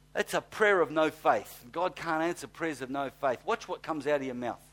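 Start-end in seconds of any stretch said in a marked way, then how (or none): none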